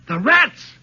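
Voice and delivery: high, loud sudden cry; crying out together. Acted. Keepers cry out as they spot the rats, high and loud. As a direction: blurt it out in horror. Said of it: "we suddenly cried out together".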